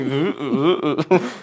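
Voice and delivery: giggly rising oohs as the car flies wildly; playful ooh-ooh while laughing; laughing ooh sounds. Retold or imitated. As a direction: coo through your laughter like you're riding it. Imitating the car flying around.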